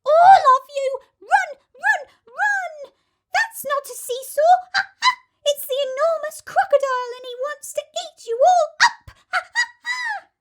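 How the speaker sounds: very high, loud chattering monkey; frantic monkey shout. A narrator's reading. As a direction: shriek and chatter in alarm. Monkey warning the children.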